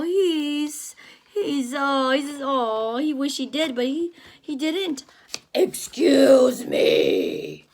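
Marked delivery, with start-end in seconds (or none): 5.5-7.7 slow, breathy shouted whisper; storybook character suddenly booming; mock loud voice. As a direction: startle everyone with a sudden big voice. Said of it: "Where did that loud voice come from?".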